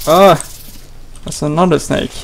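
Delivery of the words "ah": loud sudden shriek of fright; startled scream. Real, not acted. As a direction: jump and scream. Another snake appears.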